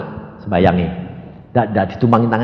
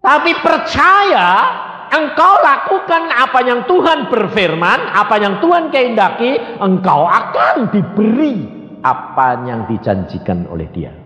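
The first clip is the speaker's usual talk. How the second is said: impassioned preaching; 11 semitones higher than usual. Real, not acted. Preacher urging the congregation to believe, fervent exhortation.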